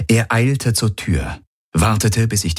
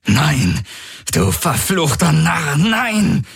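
rising to an angry shout, much higher; furious cry; 9 semitones higher, slower than usual. Acted. Valur cursing his dead accomplice, rising to a furious shout. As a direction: curse him, building to a yell.

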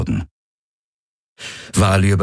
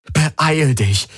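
pleading; 8 semitones higher than usual. Acted. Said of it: "flehte er".

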